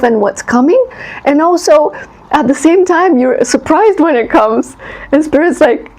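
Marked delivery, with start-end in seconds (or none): none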